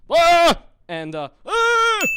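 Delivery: high, loud frustrated yelps; frustrated shout. Real, not acted. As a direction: cry out in frustration. Frustrated exclamations.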